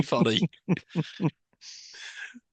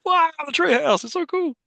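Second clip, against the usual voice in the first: put-on high, gushing childlike wonder; a kid amazed by a tree house; excited kid; 3 dB louder than usual. Remembering himself as a kid, gushing childlike wonder. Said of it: "It's like".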